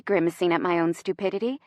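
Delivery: plain reading voice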